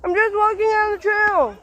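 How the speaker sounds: high, loud, defensive; a loud high complaint to whoever startled him; indignant protest. Real, not acted.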